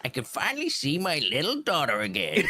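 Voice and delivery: high shouted scientist cackle; crazy scientist voice. Acted. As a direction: shout it like a mad professor. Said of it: "the way he said little daughter".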